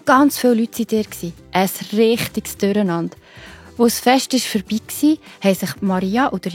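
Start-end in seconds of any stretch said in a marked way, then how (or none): none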